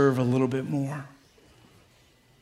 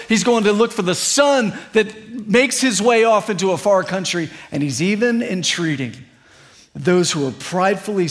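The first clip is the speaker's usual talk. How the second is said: rising, louder preaching with punched repeated phrases; full-force preaching; 5 semitones higher, 6 dB louder than usual. Acted. Pastor preaching to the church, calling prodigals home with rising fervour. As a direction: build it up and call the church home.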